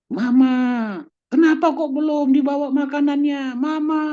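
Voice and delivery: high, loud whiny call for mama; child calling mom. Retold or imitated. Grown child at home complains to mother, high and loud whining. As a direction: call out like a fussy child wanting food.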